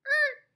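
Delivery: short high-pitched "er" blip; loud little squeak marking a full stop; quick bounce noise. Shown as a demonstration. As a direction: pop out a quick, high sound effect. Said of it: "I call it my quick bounce".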